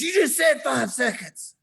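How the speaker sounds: put-on high, loud protest of a worn-out child; retold yelling. Eight-year-old protesting to dad mid-plank, yelling. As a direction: yell it back in outrage, pitched high. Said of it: "he's yelling at me".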